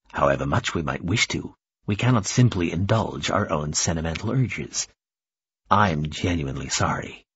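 low, measured diplomatic voice; formal regret. Acted. Formal visitor explains regretful refusal.

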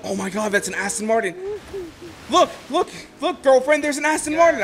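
rising to a high, excited urging; freaking out. Retold or imitated. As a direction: grab her attention, all worked up. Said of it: "I started freaking out".

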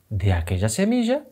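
plain reading voice

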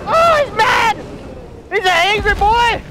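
hyped-up high-pitched shout; excited alarm. Real, not acted. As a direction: holler about the raging monster. Boss charges, enraged.

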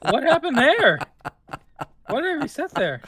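loud high laughter; laughing. Real, not acted. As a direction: crack up at the glitch. Both laugh at reset.

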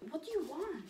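high, singsong pet voice; pet talk. Real, not acted. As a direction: coo it up high to a dog. Cooing at her dog.

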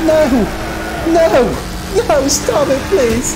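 high, desperate cries of no; panicked high shouts. Real, not acted. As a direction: beg the car to stop, panicking. Car crash during race.

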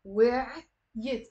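"where yit" as two separate beats, "we'-yit"; two syllables. Shown as a demonstration. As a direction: split where from yit with a small pause. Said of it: "It's two syllables".

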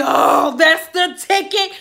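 hammed-up old man in bliss; a mock groan of pleasure from an old client; creepy old man.

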